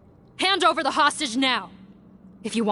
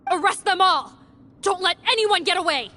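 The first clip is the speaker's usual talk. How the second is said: commanding shout; 8 semitones higher than usual. Acted. Ordering arrest in a raid.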